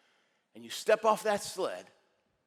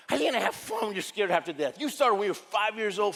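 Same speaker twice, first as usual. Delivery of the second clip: loud, raised-pitch protest; mock exasperation; 5 semitones higher, 5 dB louder than usual. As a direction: protest in hammed-up disbelief. Acted exasperated reply to coach.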